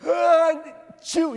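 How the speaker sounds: huge, high "achoo"; loud exaggerated fake sneeze; imitated sneeze. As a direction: sneeze explosively like a child. Imitates child sneezing in his face.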